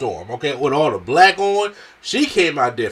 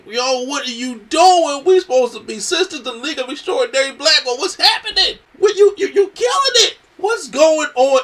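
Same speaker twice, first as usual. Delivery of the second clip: much higher, louder mock hysterical plea; hysterical Bianca; 6 dB louder than usual. Bianca banging on the chamber glass, begging her sister, hammed-up hysteria. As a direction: ham up a frantic friend pounding the glass. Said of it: "to the point where she's hysterical".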